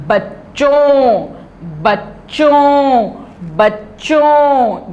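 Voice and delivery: "बच्चों" slowly, ending on a nasal vowel. Shown as a demonstration. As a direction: stretch "बच्चों" and let the end ring nasal. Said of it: "नून गुन्ना क्या होता है?".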